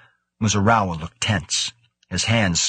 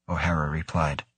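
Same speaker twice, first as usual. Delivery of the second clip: ordinary delivery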